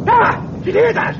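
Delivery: sudden high loud fright; frightened shout at the thunder; startled cry. Acted. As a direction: jump at the sound and cry out. Startled by a storm noise.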